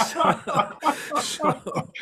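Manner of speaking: high, breathless laughter through the words; cracking up at his own joke; laughing hard. Real, not acted. Telling the host about screaming girls, laughing high and breathless.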